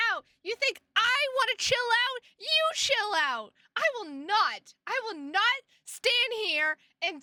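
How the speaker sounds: theatrical indignant rant; mock outrage. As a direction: play up being offended, loud and high. Mock outrage at being told chill out.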